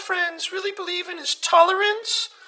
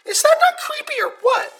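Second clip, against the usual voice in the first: high, loud, outraged question; 6 semitones higher, 5 dB louder than usual. Acted. Caller to host, scandalized and raised. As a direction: demand agreement, scandalized and loud.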